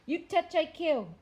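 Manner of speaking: loud, high, ferocious threat; tiny warrior growls a loud ferocious threat. Acted. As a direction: snarl it out as a fierce warning. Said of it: "the Indian growled ferociously".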